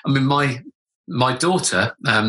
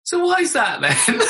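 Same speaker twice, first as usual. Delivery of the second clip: daughter's question, pitched much higher with a laugh; curious young daughter; 13 semitones higher than usual. Retold or imitated. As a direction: play the little girl asking innocently. Daughter asks through door.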